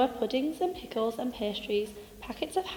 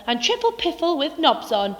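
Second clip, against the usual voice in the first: louder, raised cross badger rant; angry shouting; 5 semitones higher, 6 dB louder than usual. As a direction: yell it, fed up with visitors. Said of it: "shouted Grumpy Badger".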